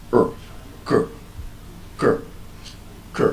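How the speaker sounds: R and K as short soft "uh", "kuh"; isolated schwa syllables, R as er, K as kuh; schwa sound. Shown as a demonstration. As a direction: say K as a weak kuh, spaced apart. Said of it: "there is a schwa sound in the first syllable".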